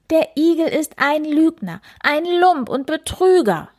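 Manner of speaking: the hare yells his anger into the woods, high; angry shout. Acted. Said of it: "dass er laut in den Wald schrie".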